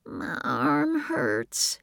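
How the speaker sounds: put-on high, slow child voice holding back tears; a small boy in pain, fighting tears; hurt little boy. A narrator's reading. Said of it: "trying hard not to cry".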